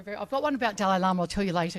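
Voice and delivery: ordinary talk, nothing marked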